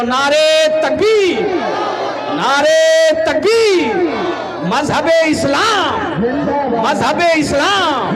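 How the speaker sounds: loud, high rallying cry; full-voiced call with stretched final word; slogan shout. Real, not acted. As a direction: lead the crowd in a shouted religious slogan. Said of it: "नारा लगाओ".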